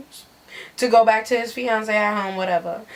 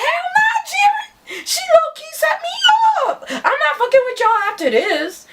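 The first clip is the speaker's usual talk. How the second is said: laughing shout. Retold or imitated. Narrator yells back, laughing.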